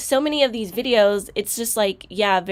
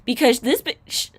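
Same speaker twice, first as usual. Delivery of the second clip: normal talking voice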